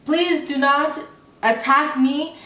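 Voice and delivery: ordinary talk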